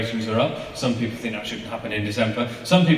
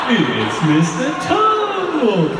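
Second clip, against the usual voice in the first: high, very slow, loud stretched clown voice; Mister Tumble loading version; 11 semitones higher, 6 dB louder, much slower than usual. Retold or imitated. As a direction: drag it out like a buffering iPad. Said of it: "doing an impression of the iPad".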